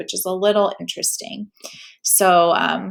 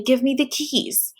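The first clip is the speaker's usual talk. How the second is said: higher, slow angry robber demand; 5 semitones higher, slower than usual. Acted. Robber pointing a gun at the boy, higher angry demand. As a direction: push him, pitch it up and hard. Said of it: "he said angrily".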